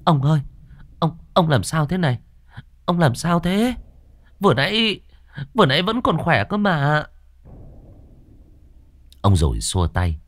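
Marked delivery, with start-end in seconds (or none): 0.0-7.0 cao giọng, hoảng hốt gọi chồng. Acted. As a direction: lo lắng dồn dập hỏi chồng ốm. (vợ hốt hoảng gọi chồng, giọng cao lo lắng)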